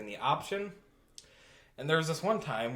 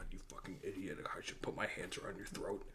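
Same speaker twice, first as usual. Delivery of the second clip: softer menacing whisper; 6 semitones lower, 16 dB quieter than usual. Pretend. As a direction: breathe a mock threat. Mock threat to co-host.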